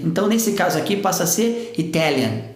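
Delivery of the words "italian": "Italian" stressed on "tal" with a clear T; stressed true T. Shown as a demonstration. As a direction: keep the T hard in "Italian". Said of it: "a tônica já está na segunda sílaba".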